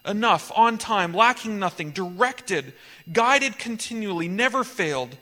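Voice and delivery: emphatic, punchy list, each phrase set apart; forceful preacher's litany with short pauses; preached litany. Acted. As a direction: proclaim each blessing like a roll call.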